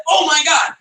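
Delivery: high, eager burst of excitement; a light imitation of someone thrilled by their own invention; mock excited inventor. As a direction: gush over a brilliant idea, half-joking. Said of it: "They're like".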